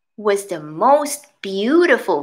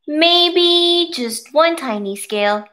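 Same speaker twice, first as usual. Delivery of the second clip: hesitant rainbow fish; 5 semitones higher, slower than usual. Acted. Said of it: "the rainbow fish thought".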